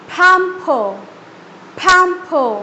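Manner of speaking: "pamper" with an aspirated puffed p; busting sound. Shown as a demonstration. Teacher demos busting sound on pamper. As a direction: burst the p of "pamper" out hard. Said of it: "बस्टिंग sound के बाद में".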